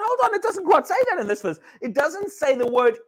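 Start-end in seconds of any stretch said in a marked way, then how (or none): 0.0-1.6 high, quick, laughing surprise; retold sudden discovery, pitched up with a laugh; surprised realization. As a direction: catch yourself mid-read, amused and startled. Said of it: "I thought".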